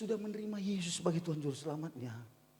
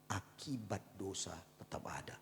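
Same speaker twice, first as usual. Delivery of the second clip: whisper; 7 semitones lower, 12 dB quieter than usual. Real, not acted. Grave warning.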